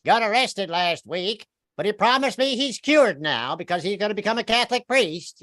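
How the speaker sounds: dish the dirt in a high, chatty voice; comic gossip spilling family news; gossipy southern woman. Retold or imitated. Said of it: "She was like".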